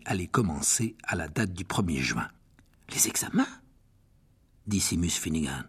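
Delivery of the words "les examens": chuchotement incrédule; chuchoté. A narrator's reading. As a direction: souffler le mot, sans voix. (murmure incrédule)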